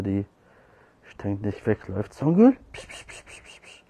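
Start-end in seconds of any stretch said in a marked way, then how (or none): 2.1-2.6 high, loud, rising name call; calling a cat. Real, not acted. Calling Songül the shy cat over, high rising call. 2.7-3.8 quick, soft, voiceless psch sounds; cat-luring psch. Real, not acted. Luring Songül to come, whispered psch calls.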